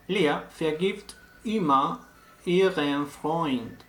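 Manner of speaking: slow, careful German sentence read out for the learners; clear German example. Shown as a demonstration. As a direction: spell it out slowly in German. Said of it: "por ejemplo". (frase alemana lenta y clara)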